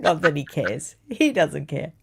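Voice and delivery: ordinary talk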